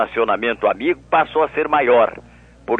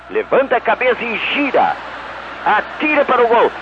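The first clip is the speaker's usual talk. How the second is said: higher, rising goal call; excited goal call; 10 semitones higher than usual. Shown as a demonstration. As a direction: lift the voice as the shot goes in. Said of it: "Faz pra nós, [name]".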